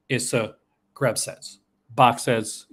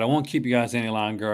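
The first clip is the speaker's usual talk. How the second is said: ordinary delivery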